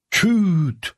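sharp high "Küt!" for a stone strike; thud sound. A narrator's reading. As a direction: snap out the impact noise. Stone hits forehead. (taşın çarpma sesi, keskin "Küt!")

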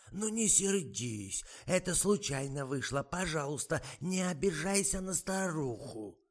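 высокий, медленный голос старухи; ноет, упрашивает, как старушка; старушечий голос. A narrator's reading. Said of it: "занудил надтреснутый голос". (высокий дребезжащий старушечий голос)

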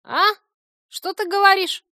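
very high, loud creature voice; loud, high, innocent query; feigned deafness. A narrator's reading. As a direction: pretend you can't hear a thing. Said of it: "Корняга вдруг скоропостижно оглох".

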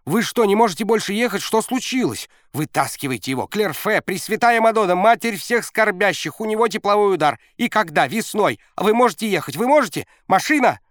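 high, loud frantic questions; panicked coach. A narrator's reading. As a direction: shout in a panic. Coach panicking at stopped car.

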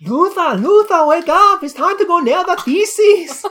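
high, loud, hammed-up German accent; amateur German accent. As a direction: rouse a sleeper in a badly faked German accent. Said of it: "best German accent I can do".

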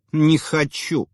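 high petulant pout; whiny spoiled pouty drawl. A narrator's reading. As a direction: whine like a spoiled child. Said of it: "закапризничала она".

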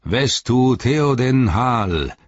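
slow, high, ringing salute; called out. Acted. Eomer hailing the restored king, slow ringing cry. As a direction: hail the king with a drawn-out call. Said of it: "rief Eomer".

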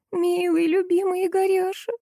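high, monotone lament; sobbing wail. A narrator's reading. Salesgirl sobs for her husband, high wail. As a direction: whine out endearments on one high note. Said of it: "рыдающую девушку".

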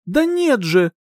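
high-pitched irritated retort. A narrator's reading. Heroine answers the prince's question, irritated. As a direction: toss it back in irritation. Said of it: "раздражённо бросила".

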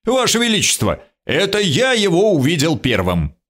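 high, insistent call; booming cry. A narrator's reading. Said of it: "крикнул второй зычно".

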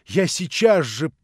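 loud, high, impassioned confession; rapturous remorse. A narrator's reading. Alyosha confessing to Natasha in the flat, remorseful outburst. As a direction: confess in a gush of repentance. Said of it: "с восторгом и с раскаянием".

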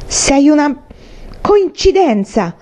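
high, slow, drawn-out angry retort; furious. A narrator's reading. Pale boy answers his bully, furious. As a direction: throw it back as a heavy insult. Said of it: "disse Walter furioso".

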